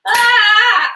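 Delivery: a loud burst of a sneeze; sneezing. Real, not acted. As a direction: let a real sneeze out. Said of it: "I'm really gonna sneeze".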